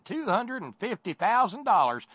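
hammed-up Russian accent, high and loud; mock Russian accent. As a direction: play a Russian lobbyist with a thick fake Russian accent. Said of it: "like my stupid Russian accent".

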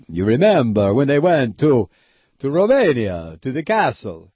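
an American lightly mocking a Romanian accent. Host recalls the Dracula movie in a light mock Romanian accent. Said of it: "why they all talk like that".

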